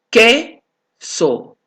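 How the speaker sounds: teacher pronounces "queso" carefully so the U is not heard; careful demo. Shown as a demonstration. Teacher showing students the silent U in "queso". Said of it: "Aquí, ¿quién su-, suena la U?".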